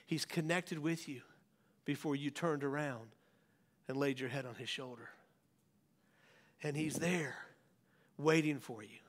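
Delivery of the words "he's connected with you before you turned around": ordinary talk, nothing marked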